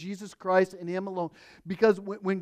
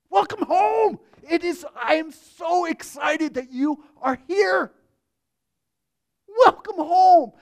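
high, loud, joyful shout of welcome; ecstatic welcome; 10 semitones higher, 8 dB louder than usual. Pretend. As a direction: cry out welcome home with open arms. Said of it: "you'll hear Him say".

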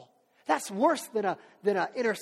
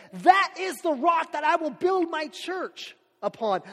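proclaiming; 8 semitones higher, 7 dB louder, slower than usual. Acted. Jesus proclaims it grandly.

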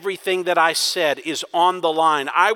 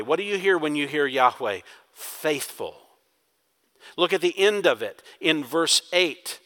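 normal talking voice

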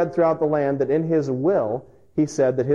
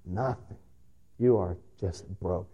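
soft, lower echo of the word; hushed repetition; 5 semitones lower, 8 dB quieter than usual. Real, not acted. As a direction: repeat it quietly, letting it sink. Repeated for emphasis.

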